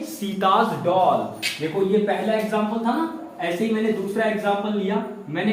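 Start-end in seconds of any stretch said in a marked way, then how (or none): 0.0-1.2 slow repeated demo. Shown as a demonstration. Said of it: "क्या कहा मैंने".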